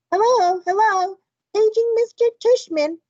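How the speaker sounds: mock old lady paging over the PA; fake high old lady voice. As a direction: ham up a sweet old lady announcer. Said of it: "fake high old lady voice".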